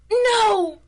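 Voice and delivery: high, loud disbelieving cry; stunned villain seeing the hero appear; shocked outcry. Acted. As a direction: blurt it out in shock. Villain sees the hero return.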